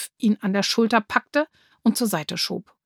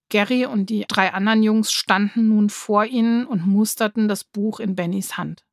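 ordinary delivery, nothing marked

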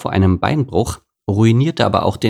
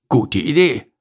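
snorting gorilla; 4 semitones higher than usual. Acted. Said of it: "schnaubte Gerhard".

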